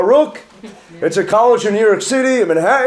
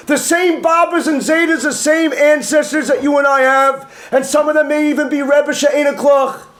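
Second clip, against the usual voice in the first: fervent preaching; 5 semitones higher than usual. Acted. Rabbi's rising celebratory preaching.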